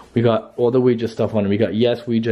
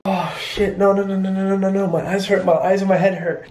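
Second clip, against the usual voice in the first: panicked; 6 semitones higher than usual. Real, not acted. Seeing the wrecked house.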